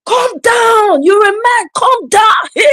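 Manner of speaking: high, loud shouting challenge; mock shout. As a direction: yell at the man to calm down as if squaring up for a fight. Father shouting at the driver.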